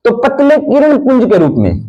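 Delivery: high, lively first take of किरण पुंज; first statement. Shown as a demonstration. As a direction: raise the pitch on तो पतले किरण पुंज के रूप में as you first state it. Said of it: "किसके रूप में?".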